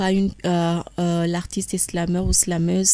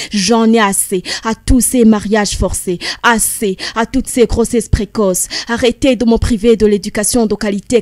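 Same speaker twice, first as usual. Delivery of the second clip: louder, defiant slam outcry; angry; 3 semitones higher, 10 dB louder than usual. Acted. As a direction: declaim it with building fury. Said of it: "J'ai senti une telle colère en toi".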